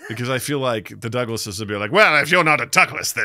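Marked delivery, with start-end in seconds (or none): 1.9-3.3 louder, mock-indignant objection; hammed-up pompous protest; pompous Douglas. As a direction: put on a huffy Douglas voice. Mimics snooty Douglases objecting.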